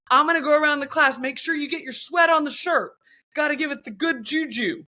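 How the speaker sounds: rally the room like a zealous coach; hyped instructor. Retold or imitated. Mimicking the instructor rallying class, loud and pumped. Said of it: "And he goes".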